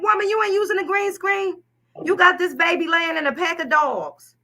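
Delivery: high-pitched mock outrage; incredulous telling-off; indignant scolding. Acted scolding of the photographer.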